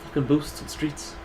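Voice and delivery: put-on Ogren catchphrase voice; lightly voiced Ogren impression. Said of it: "Do your Ogren".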